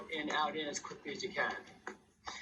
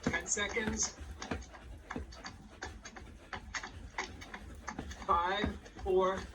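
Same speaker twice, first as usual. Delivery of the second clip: quick, higher coaching calls; aerobics instructor; 3 semitones higher, 5 dB quieter, slower than usual. Acted. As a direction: drive the class to move fast. Cueing class during ins and outs.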